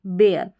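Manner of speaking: बेयर as "bay-er", falling at the end; correct vowel, ay-er. Shown as a demonstration. Teacher repeating the correct word for learners, firm model बेयर. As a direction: land firmly on बेयर with an ay vowel. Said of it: "बेयर इसका सही उच्चारण है".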